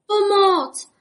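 high, loud cry for help; frightened shriek. A narrator's reading. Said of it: "zaječel Bobek". (vyděšený výkřik)

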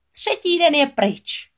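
high, slow, loud triumph; triumphant cry. A narrator's reading. Sea witch crowing that the third day is over, slow and triumphant. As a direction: crow it out in victory. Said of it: "zvolala čarodějnice vítězně".

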